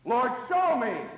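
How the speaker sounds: loud, slow, earnest cry; loud plea. Acted. Preacher modelling the prayer, a loud slow cry. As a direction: call out to God for help.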